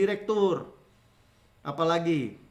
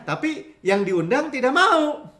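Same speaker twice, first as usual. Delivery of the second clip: ordinary talk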